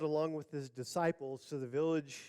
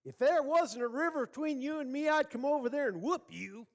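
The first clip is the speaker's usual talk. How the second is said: yelling; 9 semitones higher, 3 dB louder than usual. Acted. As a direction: yell a mock threat over the water. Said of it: "yell, go down to the riverside".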